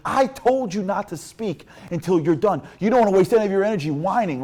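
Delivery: loud, high stern reprimand; scolding coach. Retold or imitated. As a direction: lay into a whining player. Coach scolds complaining player.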